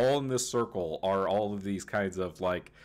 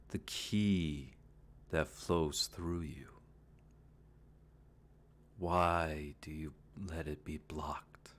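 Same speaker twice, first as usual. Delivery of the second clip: eerie voice in the mind; 4 semitones lower, 7 dB quieter than usual. Acted. Mysterious voice in Roger's mind.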